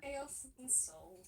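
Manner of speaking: slow eerie whisper; ominous whisper. Pretend. Answering the table's God joke, slow spooky whisper. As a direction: breathe it out slowly like a secret.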